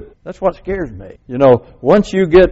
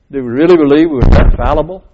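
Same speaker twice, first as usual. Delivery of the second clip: usual speaking voice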